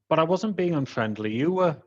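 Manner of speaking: acted protest, rising high on the retort; indignant retort. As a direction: throw the accusation back, high-voiced. Retold quarrel, answering an accusation.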